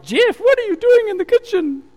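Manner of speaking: high, loud imitated shriek across the room; retold shriek. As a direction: shriek her alarm through the servery. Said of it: "she shrieked at me".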